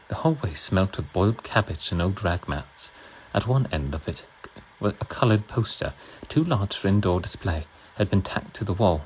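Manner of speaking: measured put-on British narration; British accent. Streamer reading Orwell to viewers in a British accent. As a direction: read the novel aloud with a British accent. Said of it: "I'll do it in a British accent".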